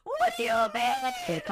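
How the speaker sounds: high sobbing shout; high crying outburst about the stolen underwear; crying outrage. Real, not acted. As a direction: wail it through tears. Tearful outrage at HR.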